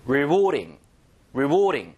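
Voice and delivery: "Rewarding" punched loud on the middle syllable; careful word stress. Shown as a demonstration. Teacher drills the word, stress on the middle of "Rewarding". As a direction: hit the "WARD" in "Rewarding", then fall away. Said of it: "강세 잘 처리하시고".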